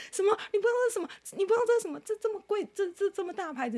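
getting worked up. Retold or imitated. Friend baffled she doesn't know DNG, imitated agitated pitch climb. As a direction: imitate his growing disbelief. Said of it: "他要激动起来了".

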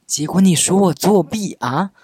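indignant outcry. Retold or imitated. Retelling her diary complaint against the teacher, an indignant cry. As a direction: wail it out like a wronged kid. Venting anger from old diary.

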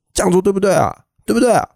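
high, scolding mock sergeant; imitated yelling sergeant. Retelling the sergeant berating trainees at the range, mock yelling. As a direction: bark the questions like a drill instructor. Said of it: "一直骂，然后就是很大声".

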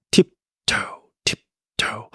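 slow, separated tip... toe steps; tiptoe sound. Shown as a demonstration. Teacher imitating tiptoe walking, slow spaced syllables. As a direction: place each syllable like a careful tiptoe step. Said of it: "脚尖走路的声音".

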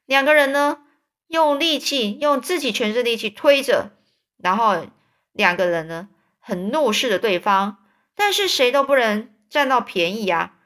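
ordinary delivery

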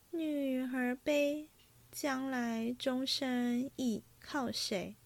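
slow, measured recital of a verse; verse recitation. A narrator's reading. Singing girl recites her drinking-game verse, slow recitation. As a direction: recite it line by line, drawing it out.

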